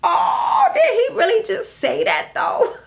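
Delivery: high, stunned "did he really" reaction; shocked disbelief. Retold or imitated. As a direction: act floored by what you heard. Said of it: "I was listening like".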